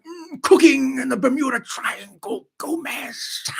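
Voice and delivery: very high, strained eccentric uncle voice; Uncle Fester. Acted. Uncle Fester talking to Wednesday, high strained hammed-up impression. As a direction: squeal it theatrically, stretching the words. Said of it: "Uncle Fester's like".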